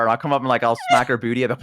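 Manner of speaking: usual speaking voice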